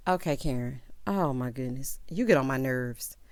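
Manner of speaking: low-pitched weary exasperation; low, exasperated aside; fed up. Real, not acted. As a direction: drop the voice, done with Karen. Fed up with Karen.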